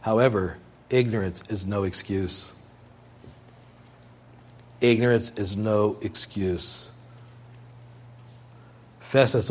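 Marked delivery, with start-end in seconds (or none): 0.9-2.5 quiet and low, stress on "ignorance"; preacher stating the rule, quietly; soft statement. Shown as a demonstration. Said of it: "repeated maxim". 4.8-6.9 slow and measured, "ignorance" falling; preacher driving the rule home, slowly; slow emphatic. Shown as a demonstration. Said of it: "repeated maxim".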